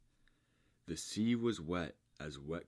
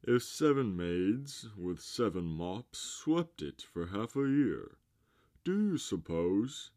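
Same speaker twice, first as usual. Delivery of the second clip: pompous walrus; 4 semitones higher, 6 dB louder than usual. A narrator's reading. Walrus asks the carpenter about sweeping sand, pompous character voice. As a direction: project it as a walrus posing a grand question.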